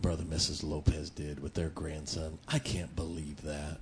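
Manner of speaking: low, hushed grumbling under the breath; gossipy murmur. Shown as a demonstration. Preacher mimicking a churchgoer's hushed, gossipy complaint. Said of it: "That's murmuring".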